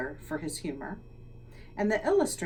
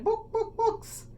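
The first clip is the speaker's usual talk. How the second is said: clucking; slower than usual. Acted. Hen clucking among sounds.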